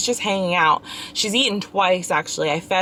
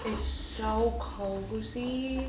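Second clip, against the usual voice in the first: very soft, very slow, at her usual pitch; soothing; 13 dB quieter, much slower than usual. Real, not acted. Mother swaddling fussy baby.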